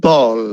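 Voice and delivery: Bowl as low, firm "bohl"; correct pronunciation. Shown as a demonstration. Teacher giving the right Bowl, correct pronunciation. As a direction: round the O in Bowl. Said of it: "Not bowl".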